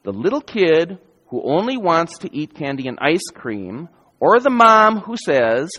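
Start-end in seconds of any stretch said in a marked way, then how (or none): none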